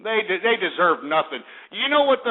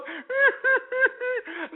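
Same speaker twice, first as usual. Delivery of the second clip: high whimpering fake sob; a hammed-up wail between words; mock sob. Mocking the crying victim, fake high sob. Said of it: "she was sitting there crying".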